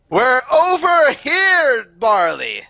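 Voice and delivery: high, loud, drawn-out holler; calling out. Acted. Valaris calling to lost Marley across the river, drawn-out shout. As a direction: shout it long for a lost friend to hear.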